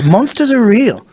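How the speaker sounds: hammed-up Australian, climbing high; mock Australian, high and insistent; Adam Green impression. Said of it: "worst Adam Green impression".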